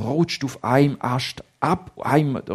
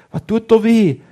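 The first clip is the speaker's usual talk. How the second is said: worried; 7 semitones higher, 8 dB louder than usual. Acted. Frightened boy asks.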